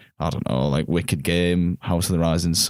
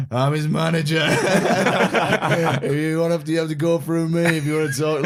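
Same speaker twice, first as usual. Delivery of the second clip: high, laughing mock boast of a self-appointed manager; drunk fan's boast; 8 semitones higher than usual. A drunk fan follows the singer round the bar, hammed-up boast. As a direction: puff up like a tipsy fan guarding the singer. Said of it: "like that".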